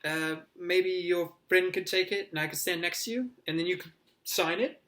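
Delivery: tentative, high-pitched begging request; imploring. A narrator's reading. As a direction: ask eagerly, angling for a favour. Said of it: "He looked up imploringly at Harry".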